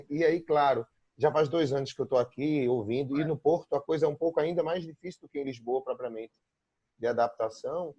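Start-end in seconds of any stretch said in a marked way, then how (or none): none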